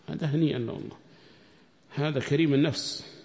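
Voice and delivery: normal talking voice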